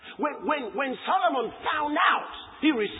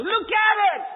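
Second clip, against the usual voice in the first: high, loud fiery preaching; shouted sermon climax; preaching at full force; 7 semitones higher, 3 dB louder than usual. Acted. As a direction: drive it home to the congregation.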